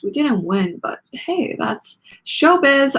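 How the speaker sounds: a playful half-shout, drawn out slowly at the end; mild group shout. A narrator's reading. Band shouting together after losing the contest. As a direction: cheer it off with a shrug. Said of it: "all seven of us shouted".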